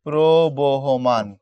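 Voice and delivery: প্রবহমান drawn out very slowly, each syllable pro-bo-ho-man clear; slow model word. Shown as a demonstration. Teacher to students, giving the right form of প্রবহমান very slowly. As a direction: say প্রবহমান slowly for the class to copy. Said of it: "মানযুক্ত হইলে হয়ে যাবে".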